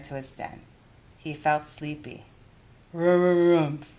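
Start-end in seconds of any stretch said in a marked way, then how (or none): 2.9-3.9 grumpy bear grunt. A narrator's reading. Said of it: "he growled".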